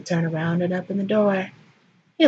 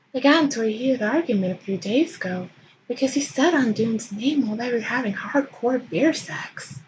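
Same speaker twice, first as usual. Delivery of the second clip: ordinary delivery, nothing marked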